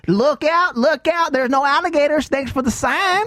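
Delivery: high, hammed-up Southern hillbilly call; hillbilly accent. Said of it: "hard to get rid of that hillbilly accent".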